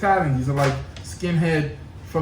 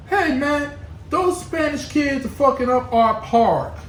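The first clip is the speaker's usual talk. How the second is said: high, put-on country accent; 8 semitones higher than usual. Kids reporting to the older kids, mock country accent. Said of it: "that sounds like a country accent".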